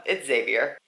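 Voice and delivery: Xavier said "ZAY-vee-er"; settled pronunciation. Shown as a demonstration. Reader confirming the name, Xavier as "ZAY-vee-er". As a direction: begin Xavier with Z, no "ex". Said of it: "'cause I've heard that too".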